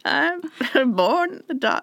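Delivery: slow, high put-on villain voice; hammy movie-villain impression breaking into laughter; Bane. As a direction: declaim like a mock supervillain. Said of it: "just becomes Bane".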